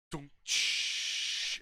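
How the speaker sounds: put-on slow breathy shushing static, like an old TV warming up; TV static noise. Recalling his garage TV turning on, a slow hissing static imitation. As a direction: hiss out the static of a big box TV.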